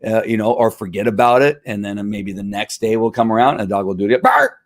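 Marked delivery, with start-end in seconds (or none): 4.2-4.6 high, loud imitated single bark; dog bark imitation. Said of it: "your sound effects are spot on".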